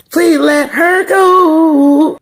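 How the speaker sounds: high, loud, drawn-out wail; hammed-up pleading shout; mock plea. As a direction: beg the show dramatically to release her.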